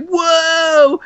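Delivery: long, high cry of whoa; excited whoa. Retold or imitated. Fan yelling at the camera, high drawn-out whoa. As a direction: wail it out like a cartoon reaction.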